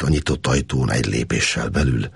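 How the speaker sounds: ordinary delivery, nothing marked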